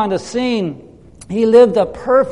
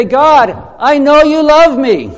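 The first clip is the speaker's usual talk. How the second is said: declaring aloud; 7 semitones higher, 6 dB louder than usual. Shown as a demonstration. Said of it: "you can say".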